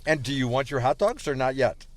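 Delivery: ordinary talk, nothing marked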